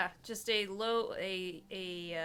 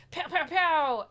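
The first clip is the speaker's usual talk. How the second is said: loud, higher "pow" sound effects; mock gunfire; 5 semitones higher, 7 dB louder, slower than usual. Player acts out jumping up to shoot the creature, mock gunfire. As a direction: punch out pretend shots, loud and high.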